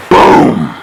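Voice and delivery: deep unvoiced boom; boom sound effect. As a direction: blurt a whispered boom like an impact.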